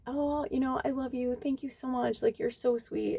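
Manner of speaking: high, soft gushing thank-you; sugary sweet voice. Retold or imitated. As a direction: gush sweetly, a touch put on. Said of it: "I was just like".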